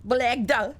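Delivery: hammed-up Jamaican accent on Blegda; mock Jamaican accent. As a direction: imitate the vine girl's Jamaican try at Blegda. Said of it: "that one vine where she tries to do the Jamaican accent".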